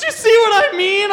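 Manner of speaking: very high, loud mock exasperation; exasperated kid. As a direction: cry it out high as the soaked awkward kid. Soaked awkward kid exclaims, acted.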